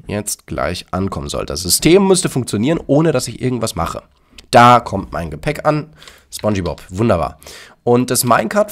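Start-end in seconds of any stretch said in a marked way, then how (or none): none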